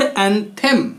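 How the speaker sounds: एंथेम with a clear "them" second syllable; stretched एंथेम, ending like "them"; wrong pronunciation. Shown as a demonstration. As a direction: say एंथेम as "an-THEM", full e vowel. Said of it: "एंथेम नहीं".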